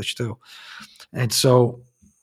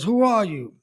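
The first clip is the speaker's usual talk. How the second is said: higher, startled question to the ghost; frightened wife; 8 semitones higher than usual. Retold or imitated. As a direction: ask it in a mild fright. Wife faces hideous figure.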